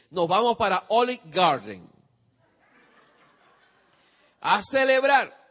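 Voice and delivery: loud, rising delighted praise; father celebrating his child's A plus; joyful celebration. Retold or imitated. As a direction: burst with pride and celebrate. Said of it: "demostración de alegría".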